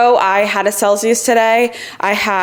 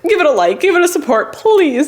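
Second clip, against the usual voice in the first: higher, coaxing plea; pleading; 7 semitones higher than usual. Real, not acted. Asking viewers to support her video, pleading. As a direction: beg for it, pitched up.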